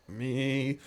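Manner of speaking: mock-timid little confession; sheepish. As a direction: own up sheepishly. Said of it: "Raise my hand kinda sheepishly".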